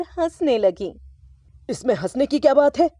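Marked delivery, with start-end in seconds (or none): 1.7-2.9 quick, cross retort; husband annoyed that his wife laughs, quick cross question; irritated father. A narrator's reading. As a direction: snap back fast at the laughing wife. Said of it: "पिताजी को गुस्सा आ गया".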